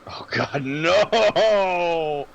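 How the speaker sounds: loud, high-pitched, drawn-out "no"; dismayed outcry. Real, not acted. Player reacting to the intimidate check, dismayed outcry. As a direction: cry out, letting the voice leap up.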